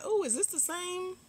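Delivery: high-pitched put-on wonder, hammed up; mock wondering. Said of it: "you be like".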